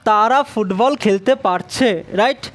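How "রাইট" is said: ordinary talk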